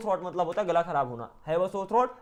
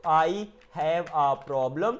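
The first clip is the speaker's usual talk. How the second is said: very slow, stretched words; much slower than usual. Shown as a demonstration. Teacher translating a computer problem for learners, word by word. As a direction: give each word its own space.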